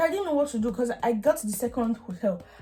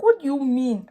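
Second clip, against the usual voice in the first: higher, louder indignant what do you mean; frustrated outburst; 5 semitones higher, 6 dB louder than usual. Retold or imitated. Venting at the airline agent, frustrated outburst. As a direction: snap it out in disbelief. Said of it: "I'm like".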